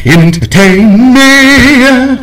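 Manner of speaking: hammed-up theatrical demand; mock grand demand. Shown as a demonstration. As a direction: stretch it out like a big finale chant.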